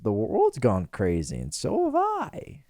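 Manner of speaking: slow, rising to a high squeaky wail; mock-dramatic slow wail; dramatic lament. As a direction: ham up the despair, climbing high. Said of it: "one of the talking points is just".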